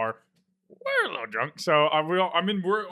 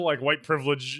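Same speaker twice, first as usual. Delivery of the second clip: ordinary delivery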